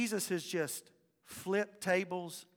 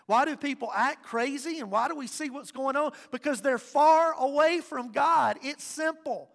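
high, loud, rising conviction; full-force preaching; 7 semitones higher, 10 dB louder than usual. Real, not acted. Pastor driving the point home to the congregation, preaching hard. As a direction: preach it out to the room.

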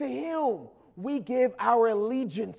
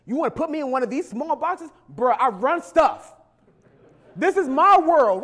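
loud, higher, brash street-talk swagger; Jesus as street boss; 3 semitones higher, 5 dB louder, faster than usual. Retold or imitated. Jesus mocks people boxing him into a party, brash and loud. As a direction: talk like you run the block, daring them. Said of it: "Jesus says".

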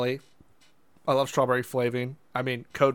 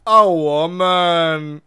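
catchphrase; 3 semitones higher, 7 dB louder, much slower than usual. Retold or imitated. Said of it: "things that got stuck in my head".

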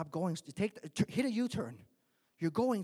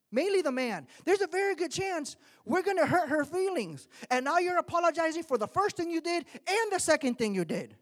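louder, higher preaching push; fiery preaching; 7 semitones higher, 4 dB louder than usual. Acted. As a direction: preach it hard to the congregation. Preaching at full force.

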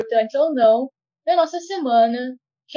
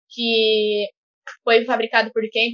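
usual speaking voice

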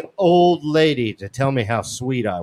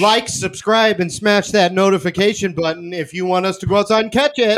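mock YouTuber outro; 4 semitones higher than usual.

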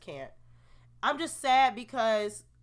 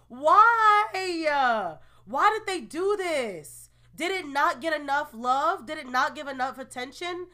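higher, louder cry of dismay; upset disbelief; 5 semitones higher, 6 dB louder than usual. Real, not acted. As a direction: wail why at the cancellation. Upset over show cancellation.

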